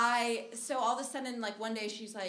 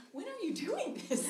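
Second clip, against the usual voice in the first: high, laughing disbelief; can't believe it happened; high incredulous laugh; baffled; 11 semitones higher than usual. Retold or imitated. Asking roommate about photo shoots.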